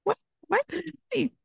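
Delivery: shocked outburst. Retold or imitated. Groom hearing about the ER visit, shocked cry. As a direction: react as if blindsided by the news. Husband's shocked reaction retold.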